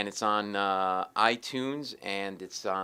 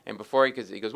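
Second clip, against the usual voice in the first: usual speaking voice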